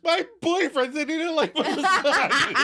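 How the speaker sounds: very high mock wail; Monica erupting into tears. Girlfriend wails about her boyfriend, hammed-up crying. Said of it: "And Monica erupts into tears".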